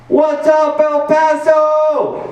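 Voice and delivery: very high, loud, stretched-out crowd greeting; hype stage greeting. Retold or imitated. Rookie comic rushing on stage, greeting the crowd with a loud drawn-out hype.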